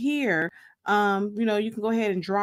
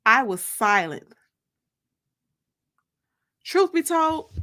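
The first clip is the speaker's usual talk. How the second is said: story narration. A narrator's reading. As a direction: read it like a dramatic reading for your listeners. Reads her book aloud.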